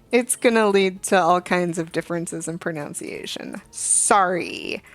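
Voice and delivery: ordinary talk, nothing marked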